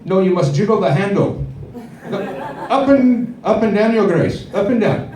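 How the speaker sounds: high, laughing posh butler voice; a fussy servant coaching his master. Pretend. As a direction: servant instructing a rich master, hammed-up high voice. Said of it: "you probably never heard these words".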